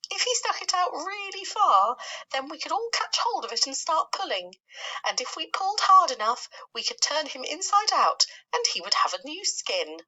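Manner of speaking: a doom-saying worm predicting the worst; lightly voiced gloomy earthworm. Earthworm proposes a grim idea.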